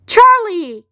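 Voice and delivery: high, loud delighted exclamation; crying out. A narrator's reading. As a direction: cry the name in admiration. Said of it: "cried Mister Wonka".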